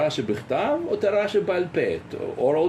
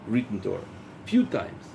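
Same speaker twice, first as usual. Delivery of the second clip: ordinary talk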